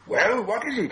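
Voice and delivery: high-pitched, impatient demand. A narrator's reading. Bilbo tired of waiting for Gollum, impatient. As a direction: press for an answer, voice jumping up. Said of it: "Bilbo became impatient".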